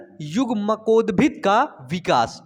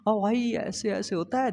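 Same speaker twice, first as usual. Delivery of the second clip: speaking softly; 7 dB quieter than usual. Shown as a demonstration. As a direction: demonstrate an uselessly quiet teacher voice. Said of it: "धीरे धीरे बोलूंगा ऐसे".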